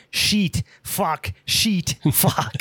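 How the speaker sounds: mock Australian accent, vowels stretched; terse Australian-accented swearing, word by word. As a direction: curse in a hammed-up Australian accent. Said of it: "shit in an Australian accent".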